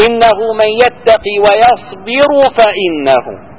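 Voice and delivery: slow recitation with the ra of ويصبر stopped; recited verse. Shown as a demonstration. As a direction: chant إنه من يتقي ويصبر فإنه, running ويصبر straight into فإنه. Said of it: "كل". (تلاوة ويصبر بسكون الراء)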